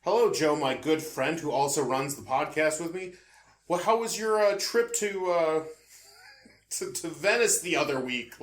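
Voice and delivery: mock-formal movie dialogue; overly formal scripted small talk; stilted expository dialogue. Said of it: "you mean you don't like".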